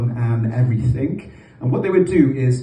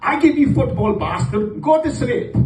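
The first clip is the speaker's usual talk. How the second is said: threatening Pakistani dad; 10 semitones higher than usual. Retold or imitated. Dad threatening noisy kids at bedtime, high mock anger. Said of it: "Then he would say to us".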